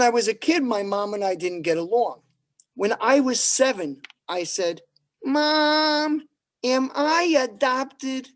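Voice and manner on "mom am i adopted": put-on higher, slow little-boy question; child asking his mother; mock kid voice. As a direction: ask like a worried seven-year-old. Child self voiced in a joke.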